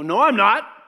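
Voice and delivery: quick huffy denial; mock defensive denial. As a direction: snap back defensively. Acted marital quarrel, denying.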